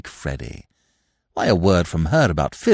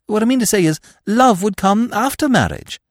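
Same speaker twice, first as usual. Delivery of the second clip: high, breezy upper-class twit; chatty, airy young gentleman; silly young toff; 13 semitones higher, 4 dB louder than usual. A narrator's reading. Freddy explains.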